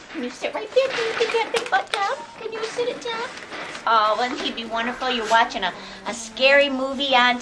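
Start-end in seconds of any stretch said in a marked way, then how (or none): 0.1-3.3 higher, coaxing pet voice; pet talk. Real, not acted. Asking the dog to sit down, high coaxing. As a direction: sweetly urge him to sit, pitched up.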